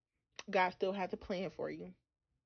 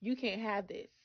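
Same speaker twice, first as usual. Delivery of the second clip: lightly voiced, higher mock taunt; mock teasing God; 6 semitones higher than usual. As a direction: tease like dangling a prize. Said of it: "He's not a God that he's gonna say".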